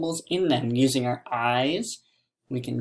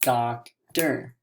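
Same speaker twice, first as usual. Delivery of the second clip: DOCTOR in two separate beats, doc, tor; DOCTOR with a gap, doc-tor; split into syllables. Shown as a demonstration. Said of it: "Again, two syllables".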